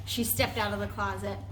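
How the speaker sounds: ordinary delivery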